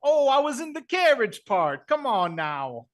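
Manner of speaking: Brooklyn Vinny voice. Acted. Contestant introducing himself in a brash Brooklyn accent. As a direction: play a cocky Brooklyn guy on a dating show. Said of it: "Brooklyn Vinny".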